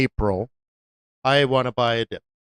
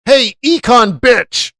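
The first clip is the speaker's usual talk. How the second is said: slow, higher taunting jeer; mock taunt; 7 semitones higher, 5 dB louder, slower than usual. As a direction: sneer it like a cocky client. Mocking a client's taunt.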